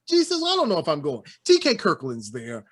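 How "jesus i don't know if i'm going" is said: fan voice. Retold or imitated. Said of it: "You say".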